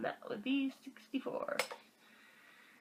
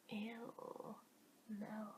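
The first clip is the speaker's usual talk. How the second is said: hushed grossed-out ew; whispered disgust; 2 semitones lower, 17 dB quieter than usual. Real, not acted.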